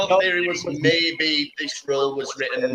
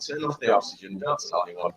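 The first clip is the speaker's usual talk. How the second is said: conspiratorial hush; 7 semitones lower, 8 dB quieter than usual. Retold or imitated. Panicking crew hushing it up.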